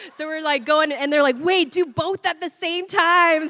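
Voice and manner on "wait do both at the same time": louder, higher, stretched-out mock excitement; excited urging. Stoned friends urge touching carpet and dog together, excited urging.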